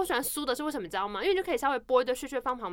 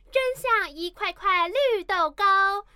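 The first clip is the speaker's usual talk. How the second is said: recitation contest reading; 5 semitones higher, slower than usual. Shown as a demonstration. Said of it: "朗读比赛".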